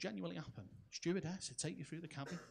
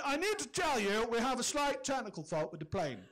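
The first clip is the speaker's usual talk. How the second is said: a touch of smug, higher announcing; hint of a cocky pilot, louder; smug captain; 5 semitones higher, 4 dB louder than usual. Captain over tannoy, mildly smug and louder. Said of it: "smugness in his voice".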